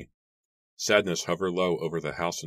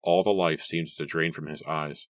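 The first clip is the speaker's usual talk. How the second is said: ordinary delivery, nothing marked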